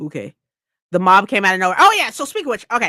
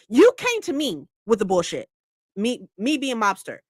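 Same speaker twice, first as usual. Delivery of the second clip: ordinary delivery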